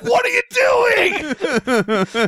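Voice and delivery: slow, high horrified wail. Retold or imitated. Waking to see friend scratching Bill, slow high horrified cry. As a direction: drag out a shocked cry.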